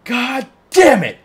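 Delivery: very high, loud, slow enraged shout; Frank's slow, screaming rage; furious Frank yell. Retold or imitated. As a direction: explode like Frank throwing a fit. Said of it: "he's just all like".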